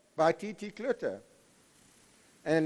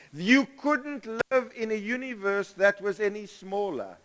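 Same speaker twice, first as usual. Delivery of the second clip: normal talking voice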